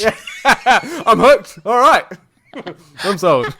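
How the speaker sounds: loud, high laughing reply; laughing loudly. Real, not acted. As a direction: burst out laughing through the words. Laughing at friend's hype.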